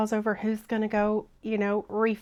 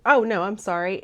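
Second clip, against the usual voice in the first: normal talking voice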